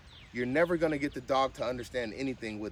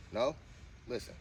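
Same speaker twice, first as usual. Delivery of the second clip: normal talking voice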